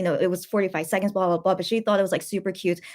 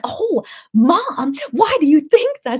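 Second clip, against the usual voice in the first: louder, higher delighted question; excited; 7 semitones higher, 8 dB louder, much slower than usual. Retold or imitated. Daughter retelling her thrilled reply to mom, loud and high. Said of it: "And I'm like".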